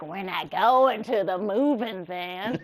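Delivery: a deadpan Buzz you can't keep straight, slow and low; laughing Buzz line. Retold or imitated.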